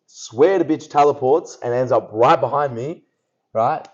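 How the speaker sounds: ordinary talk, nothing marked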